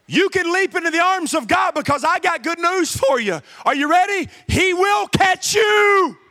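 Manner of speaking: preacher building to a loud shout to the congregation; preaching building to a shout. Acted.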